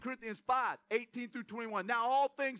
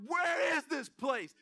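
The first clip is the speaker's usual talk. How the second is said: frustrated shout; 4 semitones higher, slower than usual. Retold or imitated. Husband lost while driving, arguing with his wife, exasperated shout. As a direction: bark it out like you're lost and fed up. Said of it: "I'm like".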